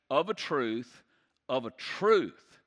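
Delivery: preacher driving home "of a truth"; emphatic repetition. Shown as a demonstration. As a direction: lean hard into the second "truth". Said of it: "you're saying it like this".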